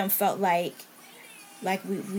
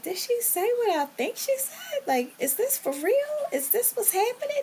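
pitched way up in stunned disbelief. Retold or imitated. Woman recalling her diagnosis thoughts, in high stunned disbelief. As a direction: replay the shock of hearing the word cancer.

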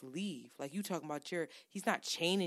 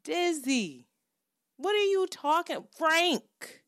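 excited rant; 9 semitones higher, 6 dB louder, slower than usual. Real, not acted. Ranting about [name]' catalog.

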